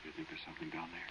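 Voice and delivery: hushed, uneasy whisper. Acted. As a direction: barely voice it, afraid. Frightened whisper in the dark.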